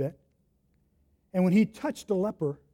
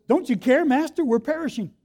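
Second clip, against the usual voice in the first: panicked plea; 6 semitones higher than usual. Acted. Frightened disciples wake Jesus.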